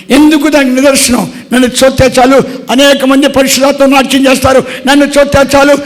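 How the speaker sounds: fiery revival preaching; full-force preaching. Acted. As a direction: build the sermon up and hammer each line.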